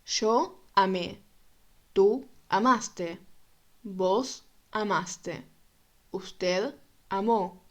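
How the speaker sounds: measured, evenly paced recitation; slow review drill. Shown as a demonstration. Teacher drills the forms with learners, slow and even. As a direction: recite each form slowly for learners.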